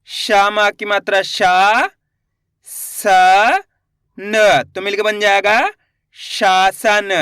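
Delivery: slow spelling-out with long vowels and gaps; letter by letter. Shown as a demonstration. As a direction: sound out each letter, then blend the word. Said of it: "एक एक अक्षर का उच्चारण करके". (एक-एक अक्षर धीरे, खींचकर)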